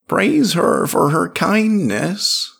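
slow, high chanted praise; ritual praise. A narrator's reading. Tribe on their knees praising Drop, slow high ritual cry. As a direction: chant it high, drawing out the words.

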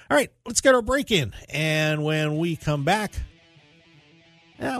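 ordinary talk, nothing marked

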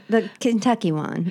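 usual speaking voice

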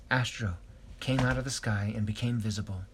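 usual speaking voice

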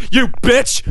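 high-pitched indignant squawk; mock outrage. Scolding travelers who return with accents, high mock outrage. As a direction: leap up high in pretend outrage.

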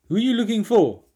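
acted-out confused callee; puzzled call recipient. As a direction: ask it high and loud, playing the baffled stranger.

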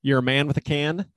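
usual speaking voice

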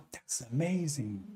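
soft, slow murmur of wonder; quietly awed, drawn out; hushed amazement. Retold or imitated. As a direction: say it slowly, softly, as if amazed. Retelling his awed inward reaction.

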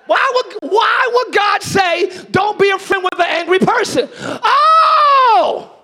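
loud, high, slow preaching cry; full-force preaching. Acted. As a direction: preach it out to the back row, stretched long.

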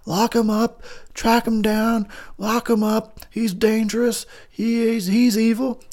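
high-pitched mock alarmist; urgent warnings in a high imitating voice; Loomis. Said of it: "all he ever says all the time is".

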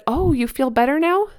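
hopeful question. Retold or imitated. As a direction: check in sweetly with a small child.

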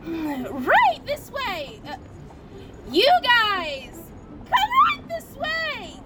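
very high, cracking elf squeal; christmas elf voice. Acted. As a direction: shout to the kids like a giddy elf. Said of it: "practicing my elf voice all year".

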